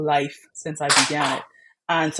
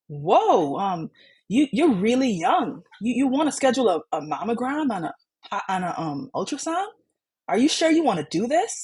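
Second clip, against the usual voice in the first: incredulous scheduler; 6 semitones higher than usual. Retold or imitated. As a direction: play the phone scheduler, openly amazed. Scheduler doubts a young patient.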